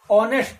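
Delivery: ऑनेस्ट said "onest", silent h; ऑनेस्ट starting on a bare "o", no h; pronunciation demo. Shown as a demonstration. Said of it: "इसका pronunciation हम क्या कर रहे हैं".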